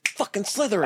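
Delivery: high-pitched snap verdict from a hat; Sorting Hat. Acted. Hat sorting a descendant of Slytherin, high put-on voice. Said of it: "when that sorting hat hits your head, it's gonna be like".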